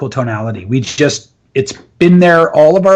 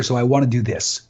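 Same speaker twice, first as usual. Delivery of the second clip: lowered starting pitch; 5 semitones lower, 6 dB quieter than usual. Shown as a demonstration. Coach demonstrating a small shift, in a lowered pitch. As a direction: drop lower and softer. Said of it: "just lower it a little bit".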